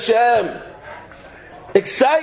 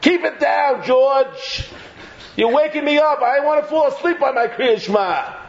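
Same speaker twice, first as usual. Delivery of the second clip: higher-pitched mock scolding; mock complainer; 5 semitones higher than usual. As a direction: complain like someone whose nap is disturbed. Acted complainer telling George off.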